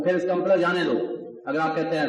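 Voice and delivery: normal talking voice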